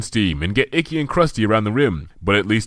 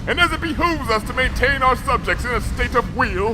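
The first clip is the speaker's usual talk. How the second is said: higher, grand archaic royal voice; regal Princess Luna; 3 dB louder than usual. A narrator's reading. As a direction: declaim like a stately monarch. Princess Luna grants a boon.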